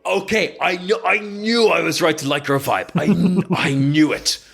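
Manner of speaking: acted glee breaking into a laugh; delighted commander. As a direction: crow that you were right all along. Commander delighted by warrior's boast.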